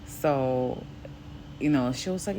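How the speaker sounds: usual speaking voice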